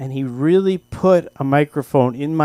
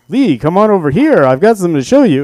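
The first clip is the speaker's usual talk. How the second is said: a louder, higher broadcaster call; announcer voice; 4 semitones higher, 9 dB louder, faster than usual. Retold or imitated. Dad calling his son over, announcer voice. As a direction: call the kid over like a radio host. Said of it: "so when he wasn't going".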